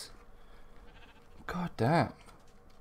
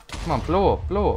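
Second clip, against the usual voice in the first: ordinary talk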